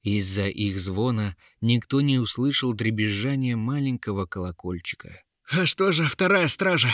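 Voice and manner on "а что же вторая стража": high, urgent appeal; Uril calling out. A narrator's reading. Embattled warrior calls for the second guard, calling out high. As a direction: call desperately for the missing guard. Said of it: "взывал Урил".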